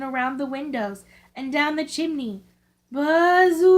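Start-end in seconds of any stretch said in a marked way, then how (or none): 2.9-3.7 the wind's voice, high and loud; eerie moaning wind sound; howling wind. A narrator's reading. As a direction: howl it like a storm wind through the chimney. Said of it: "it went".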